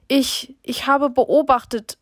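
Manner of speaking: slow, halting, voice catching on tears; a touch of a choked sob, slow and broken; stammering, choked up. A narrator's reading. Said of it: "stammelt sie und brach dann erstickt ab".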